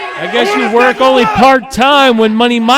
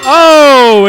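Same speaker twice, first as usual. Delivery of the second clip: loud burst of shock; louder sudden exclamation; startled oh; 2 semitones higher, 7 dB louder than usual. Real, not acted. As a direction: gasp it out at the ringside chaos. Commentator sees wrestlers collide.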